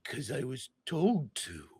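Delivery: slow, drawn-out clown voice; Pennywise. Acted. Pennywise answering why he will kill, slow and eerie. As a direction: draw the words out eerily. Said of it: "one of his eyes begins to drift".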